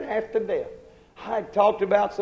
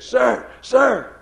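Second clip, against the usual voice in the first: calling out; 6 semitones higher, 8 dB louder than usual. Retold or imitated. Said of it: "And she said".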